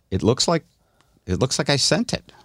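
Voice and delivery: ordinary delivery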